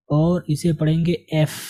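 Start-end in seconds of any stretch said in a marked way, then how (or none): 1.3-1.6 एफ opening on a clean e vowel; correct letter name F. Shown as a demonstration. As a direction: say एफ as "ef", no y glide in front. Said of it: "एफ नहीं पढ़ेंगे, क्या पढ़ेंगे?".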